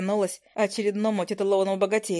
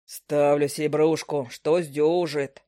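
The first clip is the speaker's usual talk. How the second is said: gruff onlooker; 5 semitones lower, slower than usual. Acted. Onlooker betting voiced.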